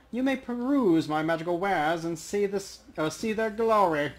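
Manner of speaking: a troll proudly showing off magic wares; grandiose troll merchant. Acted.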